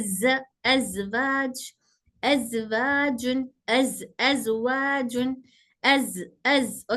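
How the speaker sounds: ज drawn out slowly with a buzzing voiced z; slow demo of ज with audible vibration. Shown as a demonstration. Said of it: "ज बोलने पर हल्का सा वाइब्रेशन महसूस होता है".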